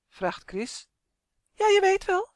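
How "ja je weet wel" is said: high, louder doll voice; disdainful doll. Acted. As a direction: explain it loftily, pitched well up. (hoge, geërgerde poppenstem)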